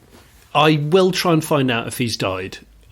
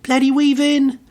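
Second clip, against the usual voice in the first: much higher, exasperated imitation of the instructor; ranting instructor. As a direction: rant at the weaving driver, half outraged. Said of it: "he'd go".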